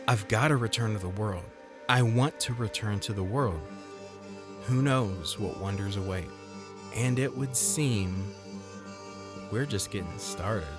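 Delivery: ordinary delivery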